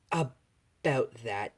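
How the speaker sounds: slow, stretched-out lead-in; sheepish hesitation. Acted. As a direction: ease reluctantly into a confession. Son must admit wrecking car.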